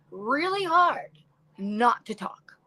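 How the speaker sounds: slow stretched really hard, then a hush on not to talk; emphatic plea. Retold or imitated. Telling her husband she tries not to talk, drawn out. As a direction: lean on hard, then whisper. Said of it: "I was like".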